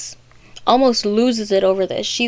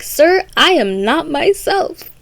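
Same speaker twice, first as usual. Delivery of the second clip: higher, drawn-out Alice; slow, raised-pitch storybook quote; Alice's voice; 7 semitones higher than usual. Retold or imitated. Alice answering the caterpillar, higher and slow.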